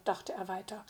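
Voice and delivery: ordinary delivery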